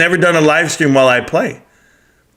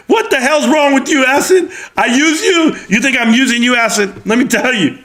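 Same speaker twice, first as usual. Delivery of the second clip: mock outrage; 7 semitones higher than usual. Mock outrage at a teasing viewer.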